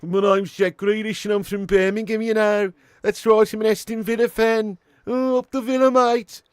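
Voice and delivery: high, loud, exaggerated Brummie accent; Brummie Jack Grealish impression. Streamer mocking an English footballer's roots, thick Brummie accent. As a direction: belt out a mock Birmingham footballer's introduction in Brummie. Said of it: "he's literally Brummie as fuck".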